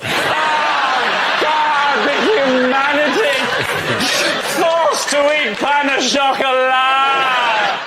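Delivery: theatrical anguish over pastries; mock outrage. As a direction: wail for the humanity. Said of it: "mock outrage at croissants".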